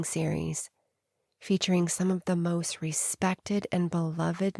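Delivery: a quietly inviting announcement; promo read. Acted.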